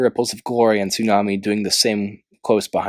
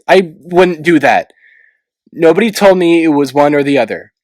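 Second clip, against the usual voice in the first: upset denial; 5 semitones higher, 6 dB louder than usual. A narrator's reading. Clay insisting to Tsunami, loud upset protest.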